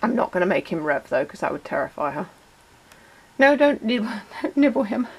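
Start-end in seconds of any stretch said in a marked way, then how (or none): 3.4-5.1 laughing through a gentle telling-off; amused scolding. Real, not acted. Owner stops guinea pig nibbling the car, laughing.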